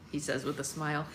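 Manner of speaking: plain reading voice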